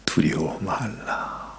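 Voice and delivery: soft, slow whisper; hushed comfort; tender whisper. Acted. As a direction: lay a hand and breathe it. Jesus touches John.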